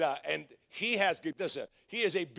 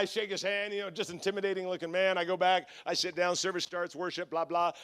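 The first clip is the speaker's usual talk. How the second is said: ordinary talk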